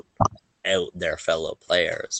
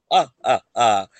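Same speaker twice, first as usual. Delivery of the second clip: mock laugh; 3 semitones higher, 4 dB louder than usual. Mock laughter after joke.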